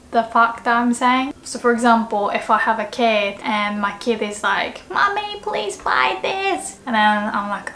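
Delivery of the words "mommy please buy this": put-on pleading kid voice.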